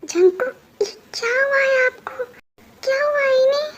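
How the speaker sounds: high, tearful and hushed; crying. Acted. Wife opens door to her drunk husband, crying in shock. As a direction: sob out her shock at seeing him.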